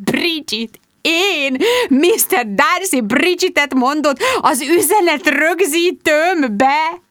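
high, slow, breathless amazement; can't believe her ears; stunned disbelief. A narrator's reading. Bridget to her friends, high and astonished.

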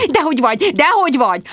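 high, urgent reassurance; hurried gabble. A narrator's reading. Bridget rushing to comfort rejected Tom, gabbling. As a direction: rattle off the denial insistently. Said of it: "azt hadarva".

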